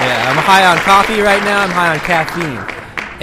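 loud hyped-up show opener; podcast intro yell. Shown as a demonstration. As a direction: yell the welcome like a host. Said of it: "me yelling has scared my".

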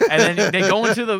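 usual speaking voice

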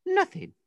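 high, sarcastic Nothing; complaining the agent never answers, high exasperated Nothing. Real, not acted. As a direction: fling the word Nothing up high. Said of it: "agent never replies".

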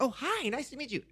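high, sugary polite greeting; overly nice. Retold or imitated. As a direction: act out being far too nice. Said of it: "I was, like, overly nice".

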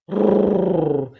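a loud growling roar; bear roar. A narrator's reading. Hotel bears growl at the humans entering, loud roar. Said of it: "growled loudly".

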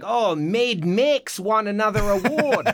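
hammed-up mangled Australian vowels; bad Australian accent. Friends joking over Mad Max awards, bad Australian accent. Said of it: "it's not even an Australian accent".

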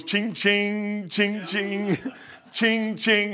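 lightly voiced cash-register chime, teasing; a playful cha-ching jab at the preacher; mock cash register. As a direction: ring it up like a till, laughing. Friend mocks with cash register.

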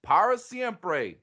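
high-pitched twangy product pitch; mock commercial tagline, Southern twang; Tex Pablo. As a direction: sell it like a hammed-up Southern adman.

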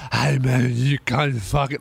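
low, garbled clubber impression; incoherent drugged clubber. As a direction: mumble it like someone wasted in a nightclub. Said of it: "so they can't speak English".

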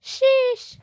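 very high, loud, stretched-out squeal; yelled meme sheesh. Retold or imitated. Kid repeats the meme for parents, high yell. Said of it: "inner elbow and yell".

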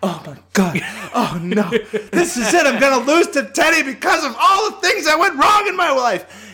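mock panic. To podcast friends, hammed-up high panic. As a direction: spiral into mock doom. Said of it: "And I'm like".

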